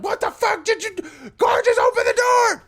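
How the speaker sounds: high, fast panicked yell; panicked shout. Retold or imitated. Trapped girls yelling at Gorgeous to open the door, mock panicked shout. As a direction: bang on the door and scream for her.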